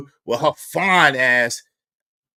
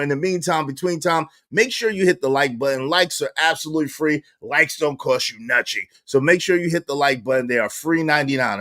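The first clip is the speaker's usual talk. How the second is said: normal talking voice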